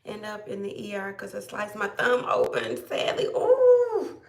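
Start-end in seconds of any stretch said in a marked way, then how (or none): 3.3-4.2 high sympathetic "ooh"; a sudden high wince for the viewer's injury; wincing ooh. Real, not acted. Reacting to a viewer's sliced thumb, high wincing sympathy.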